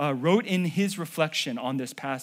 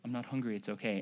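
meek quiet refusal; 6 semitones lower, 10 dB quieter, faster than usual. Retold or imitated. Mom insisting again.

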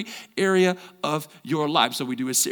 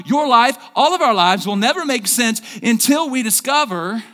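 loud, high preaching at full force; fired-up preaching; 5 semitones higher, 5 dB louder than usual. Acted. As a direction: preach it out to the whole church. Pastor preaching to congregation.

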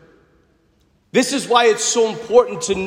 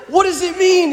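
put-on kid asking; 11 semitones higher than usual. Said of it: "they're like".

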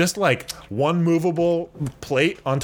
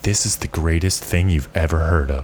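low intimate announcer voice; 8 semitones lower than usual. Retold or imitated. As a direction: lower the voice like a smooth pitchman. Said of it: "his voice was like".

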